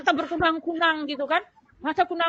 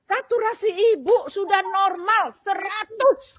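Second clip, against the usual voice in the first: high, loud and slowly spelled out; emphatic announcement; 6 semitones higher, 5 dB louder, slower than usual. Retold or imitated. Nurse telling patient the saturation is normal, imitated emphatic announcement. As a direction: proclaim good news, stretching each word.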